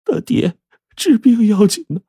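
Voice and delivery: very high, quavering wife's plea; trembling voice. A narrator's reading. Wife pleads with husband to put treatment first, trembling. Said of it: "颤声说".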